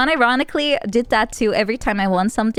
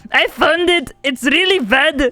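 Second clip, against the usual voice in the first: louder, much higher hammed-up German accent; a terrible German Terminator impression, loud and proud; bad fake German accent, slow and loud; shitty German voice; 5 semitones higher, 5 dB louder, slower than usual. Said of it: "impersonate Olivia doing a Gunther voice".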